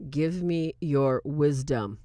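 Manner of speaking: slow, low, measured movie line; mock solemn priest. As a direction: intone it slowly like a pompous priest.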